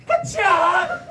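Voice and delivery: explosive high karate yell; hammed-up fighting shout; karate roar. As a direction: let out a martial-arts battle cry. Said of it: "Let me hear the roar".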